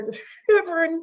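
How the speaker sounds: high, shivery little voice; high shivering bunny sound. Acted. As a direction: act out a cold bunny shivering. Rabbit shown shivering.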